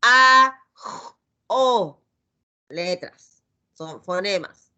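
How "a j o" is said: "a, j, o" each sound said alone, the "a" loud; letter sounds. Shown as a demonstration. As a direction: sound out "a, j, o" one by one. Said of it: "Después". ("a, j, o" separando los fonemas)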